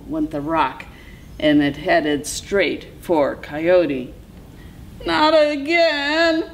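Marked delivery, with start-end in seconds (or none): 5.0-6.4 high, drawn-out yelp. A narrator's reading. Coyote sees the rock coming back, yelping. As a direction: wail it high in dismay. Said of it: "yelped Coyote".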